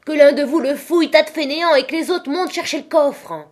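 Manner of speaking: high, loud barked-out orders; impatient ringleader shouting commands; furious yell. A narrator's reading. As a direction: yell at a lazy crew. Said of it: "Il hurla".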